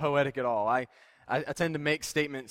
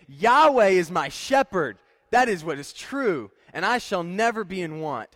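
loud, slow, joyful proclamation; David in thankful delight; 2 semitones higher, 7 dB louder than usual. Acted. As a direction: proclaim it like David on his knees, full of delight. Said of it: "bowing down on his knees before God in thankfulness and delight".

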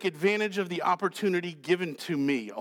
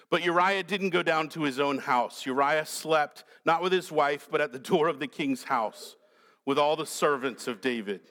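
normal talking voice

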